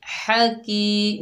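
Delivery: की in हा की held long and slow; one-count madd. Shown as a demonstration. Teacher showing students madd with ya, slow recitation. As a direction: draw the की out in chant. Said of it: "एक अलिफ की मिकदार खींच कर".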